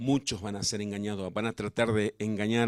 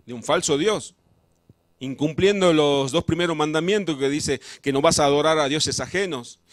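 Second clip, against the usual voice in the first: louder fiery pulpit preaching; preaching at full force; 4 semitones higher, 8 dB louder than usual. Acted. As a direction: drive it home like a preacher warning the flock.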